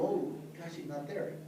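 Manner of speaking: softly, as it sinks in that he's gone; quiet realization of loss. Retold or imitated. Son remembering his late dad is gone, quietly deflated. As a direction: let it drop to a subdued, private remark.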